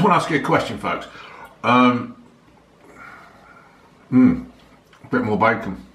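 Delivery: talking between bites; chewing. Real, not acted. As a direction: mouth full of food. Seen 1: Fork to mouth at -5, then closed-mouth chewing until 4.2.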